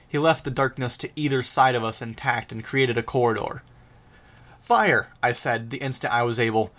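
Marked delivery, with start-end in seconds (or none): 4.6-5.1 high, sharp call; urgent warning. A narrator's reading. Flier warning driver the instant able, urgent call. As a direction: call the alarm straight away.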